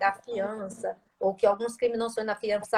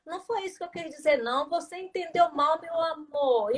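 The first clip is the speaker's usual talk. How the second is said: higher-pitched, sweet-talking backpedal; changed, placating tone; 5 semitones higher than usual. Retold or imitated. Caught offender tells the accuser it was misunderstood, mock placating. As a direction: imitate someone denying it in a raised pitch. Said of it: "muda até o tom da voz".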